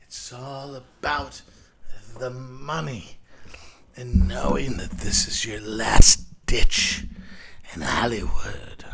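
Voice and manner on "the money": normal talking voice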